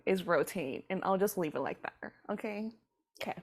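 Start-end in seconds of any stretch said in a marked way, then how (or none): none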